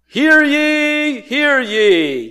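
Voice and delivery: high, loud town-crier call; herald's proclamation. Acted. Herald announcing the emperor, high ringing proclamation. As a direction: draw it out like a royal herald. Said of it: "a Yangelion was reserved for the emperor".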